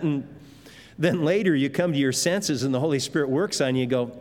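normal talking voice

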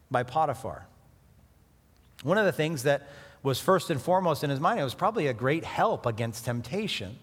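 ordinary talk

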